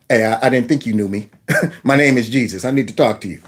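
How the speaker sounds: low and gentle, a little amused; a low, hushed Jesus introducing himself. Pretend. Jesus voiced in a hypothetical scene.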